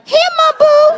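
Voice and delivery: put-on little girl voice. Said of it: "little girl runs up to you".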